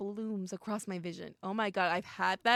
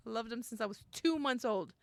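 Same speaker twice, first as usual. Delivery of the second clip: usual speaking voice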